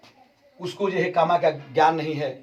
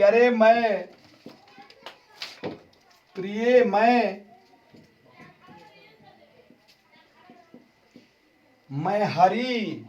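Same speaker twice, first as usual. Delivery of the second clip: drawn-out calling out; 7 semitones higher, much slower than usual. Acted. Krishna answering Radha through the door.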